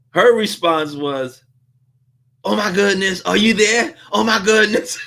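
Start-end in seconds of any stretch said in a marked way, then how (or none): 2.4-5.0 mock flustered gasp, pitched higher; imitating shocked owner. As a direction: play the caught-off-guard shop owner, a little higher. Store owner shocked on phone.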